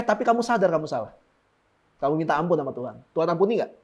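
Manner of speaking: normal talking voice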